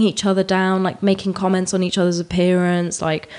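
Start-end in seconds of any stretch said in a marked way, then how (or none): none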